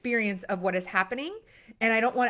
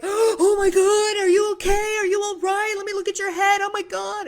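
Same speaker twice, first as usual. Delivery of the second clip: put-on high, loud, frantic Oh my God over a fallen baby; panicked fussing; 10 semitones higher, 7 dB louder than usual. Parent to a fallen baby, mock panicked and much higher, louder. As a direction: gasp and fuss like the baby's badly hurt. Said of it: "other people who are like".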